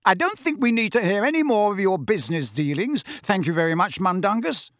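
high-pitched, sharp motherly rebuke. A narrator's reading. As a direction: cut off the storyteller sharply. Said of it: "said Missus Weasley sharply".

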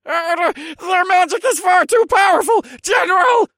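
high, loud, frightened little elf; quivering cry. A narrator's reading. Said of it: "cried out in a quivering voice".

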